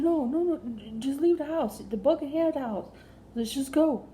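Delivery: frantic, loud refusal; panicked. Retold or imitated. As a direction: beg him to just leave, voice up high. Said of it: "I said".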